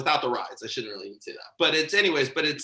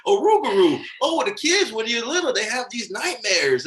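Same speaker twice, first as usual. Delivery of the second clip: put-on Keith's accent; 6 semitones higher than usual. Imitating Keith explaining a bogeyman, in Keith's accent. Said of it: "doing my best Keith accent here".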